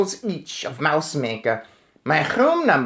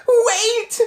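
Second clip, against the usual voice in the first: high shrieked wait; 4 dB louder than usual. Acted. Witch in the back row, high shriek. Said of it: "shrieked one of the witches".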